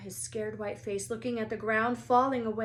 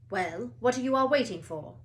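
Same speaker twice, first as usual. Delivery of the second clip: loud impatient bark; 3 semitones higher, 8 dB louder than usual. A narrator's reading. Madame Hooch barks at the waiting class. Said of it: "she barked".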